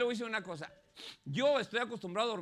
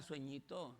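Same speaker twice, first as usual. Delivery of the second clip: much lower, very soft, tender call; coaxing sleep; 7 semitones lower, 12 dB quieter, slower than usual. Acted. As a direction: lull sleep in like a pet. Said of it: "Usted le habla". (llamando al sueño con ternura)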